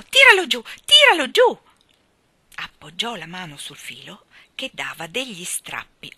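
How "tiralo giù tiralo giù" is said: high-pitched, full-voiced yelling; urgent shout. A narrator's reading. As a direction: shout the order, desperate to be obeyed. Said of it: "gridò". (grido acuto e concitato)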